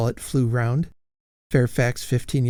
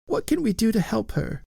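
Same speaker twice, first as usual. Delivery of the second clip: put-on high old lady ghost; 11 semitones higher than usual.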